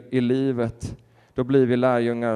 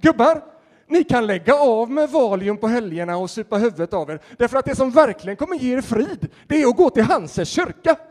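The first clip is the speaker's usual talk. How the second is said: high, loud rallying cry, laughter breaking in at the end; a booming mock sermon from the tattooed guy; loud preaching call; 9 semitones higher, 8 dB louder than usual. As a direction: harangue the old men at full volume. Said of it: "jag tar det en gång till".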